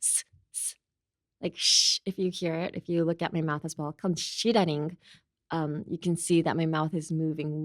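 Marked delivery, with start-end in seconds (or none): 0.0-2.0 unvoiced s aimed at one point; hissed s. Shown as a demonstration. Teacher demonstrating a focused hissed s. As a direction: hiss a thin s at a pen. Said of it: "look at a pen and be like".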